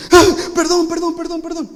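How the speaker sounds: put-on high, pleading kid's sorry; frantic apology. Brother pleading with his hurt sibling, high and frantic. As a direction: beg forgiveness in a high little voice.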